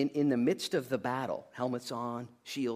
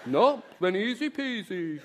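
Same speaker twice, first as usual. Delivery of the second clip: high, slow sing-song innocence; mock breezy denial; 10 semitones higher, 6 dB louder, slower than usual. Preacher mimicking congregation's denial, mock breezy. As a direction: play the liar claiming all is easy. Mocks congregation denying their faults.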